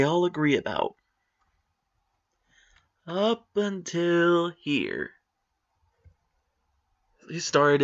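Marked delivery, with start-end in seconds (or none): 3.1-5.1 slow emphasis. Real, not acted. Dragging out the cutoff point.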